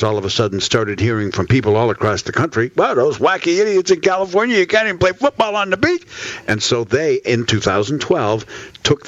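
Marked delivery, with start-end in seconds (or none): 2.7-6.1 high-pitched mock outrage; high, mock-incredulous voice of the public; incredulous callers. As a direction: imitate scandalized out-of-towners, pitched way up. Voices outraged callers from around country.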